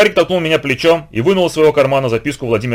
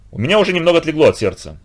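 plain reading voice

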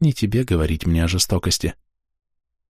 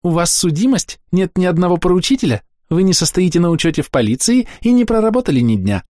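high, sweet bureaucratic chirp; chirpy young clerk; 11 semitones higher, 3 dB louder than usual. A narrator's reading. Clerk's lines continue.

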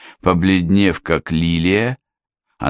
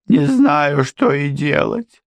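sobbing; 9 semitones higher, slower than usual. A narrator's reading. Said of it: "всхлипнула она".

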